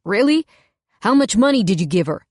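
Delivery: put-on furious man shouting a question at his girlfriend; angry demand. A narrator's reading. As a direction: confront her, shouting. Quinn confronts her, angry.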